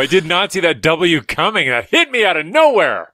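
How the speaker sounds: high, loud mock outrage at the pun; mock shock. Real, not acted. Hit by a pun, high mock outrage. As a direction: play it like a sucker punch.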